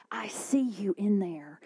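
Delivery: ordinary talk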